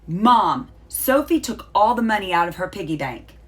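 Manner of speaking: loud tattling shout. Acted. Little sister telling on Sophie to Mom, loud shout. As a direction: yell it to get Mom's attention. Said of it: "Lena shouted".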